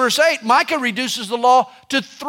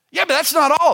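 higher and more urgent second time; mounting mock insistence; 7 semitones higher, 3 dB louder, faster than usual. Preacher voicing Paul's urgency, higher and insistent. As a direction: push it up, impatient for more.

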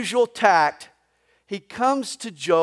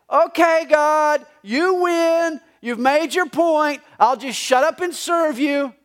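higher, louder, sulky surrender; pouting; 6 semitones higher, 4 dB louder than usual. Retold or imitated. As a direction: whine it like a sulking child. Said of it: "In essence, he's saying".

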